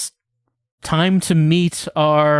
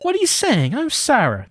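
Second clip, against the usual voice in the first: high, shrill what-are-you-saying; indignant disbelief; 10 semitones higher than usual. Acted. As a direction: sputter in shock. Sarah indignant at Joe.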